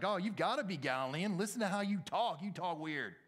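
high, loud, incredulous jeer; mocking accusation. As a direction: call him out on his odd talk. Mimicking bystander accusing Peter over accent.

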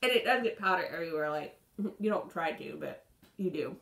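talking while brushing teeth; toothbrush in mouth. Real, not acted. Seen 1: Toothbrush in mouth, charcoal-blackened teeth throughout; brushing before and after.

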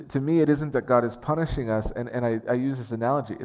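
ordinary delivery

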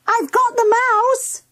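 high-pitched excited call; shouted. A narrator's reading. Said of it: "shouted Mandy".

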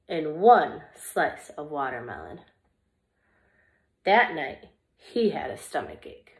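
lively, expressive storybook reading; reading to children. Acted. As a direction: read it like story time for little kids. Reads picture book to children.

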